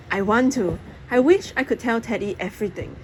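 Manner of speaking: ordinary delivery, nothing marked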